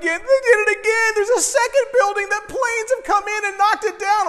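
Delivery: high, loud breaking-news shout; frantic child. Retold or imitated. Boy running back with worse news, frantic shouting. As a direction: blurt it out in a kid's panic.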